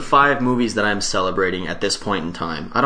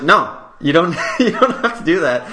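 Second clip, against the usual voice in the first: higher, laughing, embarrassed protest; flustered laughing refusal; 7 semitones higher, 4 dB louder than usual. Retold or imitated. Retelling the skater signing his board, nervous laughing protest. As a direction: laugh nervously while waving the offer off. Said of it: "I was like".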